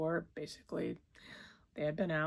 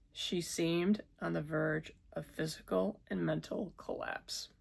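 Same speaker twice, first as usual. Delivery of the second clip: plain reading voice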